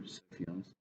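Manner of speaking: ordinary delivery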